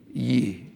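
"Ye" for "you" with lips kept back; Nashville "Ye". Shown as a demonstration. Native showing one-person "Ye", unrounded Southern pronunciation. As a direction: say "Ye" Southern-style, no pushed-out lips. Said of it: "Don't have to push your lips out".

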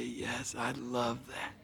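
very soft, low little reply; imitated shy child. Little Charles answering the barbecue man, imitated shy hush. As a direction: answer almost under the breath as a small boy.

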